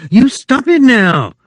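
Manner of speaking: wife crying out angrily as he musses her hair; cried angrily. A narrator's reading. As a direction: snap at him to stop. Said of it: "she cried angrily".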